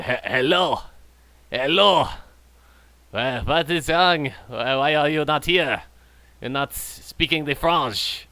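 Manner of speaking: lightly put-on French accent; imitated French accent. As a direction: imitate a player's uncertain French-or-Mexican accent. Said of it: "if this guy's Mexican or French".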